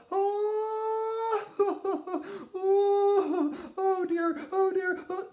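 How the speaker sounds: high, crying-laughter squeals; laughing mother. Retold or imitated. Mama laughing while being born, high laughing squeal. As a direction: cackle it helplessly, high. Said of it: "And she's all".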